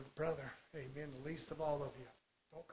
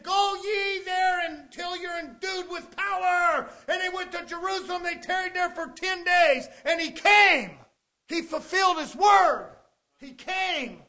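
loud, high shouted preaching; full-force preaching; 9 semitones higher, 12 dB louder than usual. Acted. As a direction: ring it out to the whole church. Preacher shouting Pentecost story.

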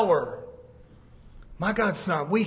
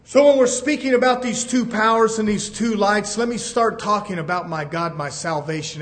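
ordinary delivery

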